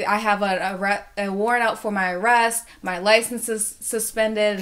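normal talking voice

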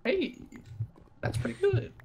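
high squeaky mock compliment; mock falsetto praise. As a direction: echo it in a silly high voice.

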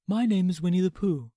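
a gentle little bear introducing himself; soft gentle teddy bear. Acted. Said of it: "said the teddy bear".

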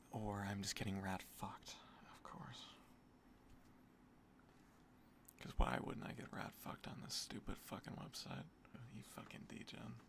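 whispered bitter curses under the breath; muttered whisper. Real, not acted. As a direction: hiss the curses to yourself. Bitter muttering over a bad case.

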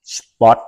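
"Spot." với âm t cuối bật rõ; nói "Spot." bật rõ âm t cuối, giọng hơi cao; clear final t. Shown as a demonstration. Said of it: "À, nói ra". (phát âm "Spot." bật rõ t cuối)

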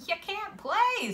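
high-pitched playground refusal; dismissive refusal. A narrator's reading. Kid refusing to let boy join, high and dismissive. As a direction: shut him out in a high kid's voice.

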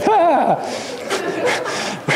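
one sharp high mocking "ha"; scornful laugh. Laughing at the movie in the cinema, high scornful "ha". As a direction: bark out a single derisive laugh. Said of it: "And I'm going".

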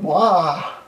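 ordinary talk, nothing marked

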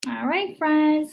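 ordinary delivery, nothing marked

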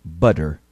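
Butter with a quick tapped D in the middle; flapped T. Shown as a demonstration. Teacher models Butter for repetition, flapped T. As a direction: say Butter like budder, light flap. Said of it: "Ouça e repita".

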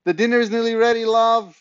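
high, loud call up the stairs; shouting up from downstairs, high and quick; Dad calling up from downstairs, high loud shout; distant shout. Acted. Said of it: "came a shout from downstairs".

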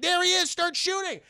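high, urgent command to fire; hammed-up high shout; mock battle order. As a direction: call out the target. Villain ordering attack.

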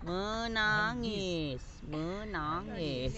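drawn-out teaching demo; teacher slowing the word down for learners, drawn out; slow model word. Shown as a demonstration. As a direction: stretch each syllable out slowly. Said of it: "Nah, menangis".

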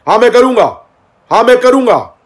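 high, loud defiant yell; mock angry shouting. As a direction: imitate a hyper guy shouting back. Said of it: "जिस लहजे में, जिस level में, जिस tone में बात हुई". (नकली गुस्से में चिल्लाना)

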